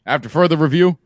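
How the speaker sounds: lightly voiced referee giving a ruling; hammed-up official's ruling; mock referee announcement. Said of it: "What's the ruling?".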